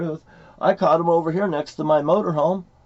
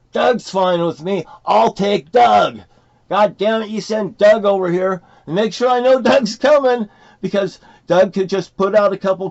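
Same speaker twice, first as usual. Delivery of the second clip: heated rant; 6 semitones higher, 6 dB louder than usual. Real, not acted. Ranting at camera about Doug.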